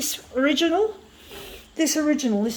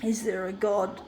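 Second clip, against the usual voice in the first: quiet; 4 semitones lower, 6 dB quieter than usual. Real, not acted. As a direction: lower and softer, almost to himself. Repeats question, quiet then shouted.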